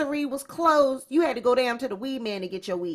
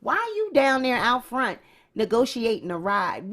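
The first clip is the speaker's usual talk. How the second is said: ordinary talk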